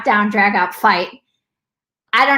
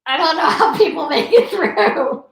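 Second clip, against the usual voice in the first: higher, put-on voice of falling apart; mock overwhelmed; 4 semitones higher than usual. Host joking about herself right after this, mock overwhelmed. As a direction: playfully act at your wits' end. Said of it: "I'll be like".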